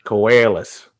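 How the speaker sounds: "Koalas" as "ko-ay-las", Australian; Australian accent. Shown as a demonstration. Host demonstrates again for cohost, Australian. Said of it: "you can try it".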